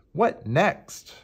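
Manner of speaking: a touch of storybook excitement; cheerful and excited. A narrator's reading. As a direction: ask it with bright-eyed eagerness. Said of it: "cried the now cheerful and excited pencil".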